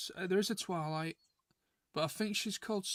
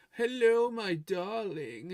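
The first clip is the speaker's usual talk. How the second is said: posh voice; 3 semitones higher, 6 dB louder, much slower than usual. Retold or imitated. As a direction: put on a posh, grand voice. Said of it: "she has a posh voice for one".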